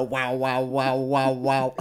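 mock greyhound bark. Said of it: "come up to you and go".

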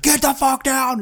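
high, strained command; hammed-up high yell; mock police order. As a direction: act out a cop's urgent order. Acting police ordering driver down.

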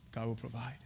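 very soft, low whisper. Acted. Abraham to his son before the sacrifice, whispered. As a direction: breathe it out under your voice. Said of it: "Just whisper it".

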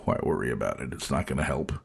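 low, soft shrug of a voice; hushed, laid-back dismissal; unbothered resignation. Acted. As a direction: drop it low and quiet, letting it go. Acts out his easygoing attitude.

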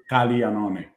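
"Caglianone" with an emphasized L for gli; Italian pronunciation. Shown as a demonstration. Co-host explaining Italian spelling to listeners, Italian pronunciation. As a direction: lean on the L in "Caglianone", G barely there. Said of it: "emphasized L with a slight accent on the G".

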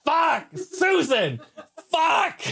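high, slow mock curse through laughter; neighbor cursing. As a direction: drag it out up high, imitating him.

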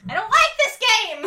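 high, loud panicky wail; frightened cry. A narrator's reading. Rumpelteazer crying out after the mantis flies off, panicked. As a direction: cry out in fear. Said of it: "Rumpelteazer cried, frightened".

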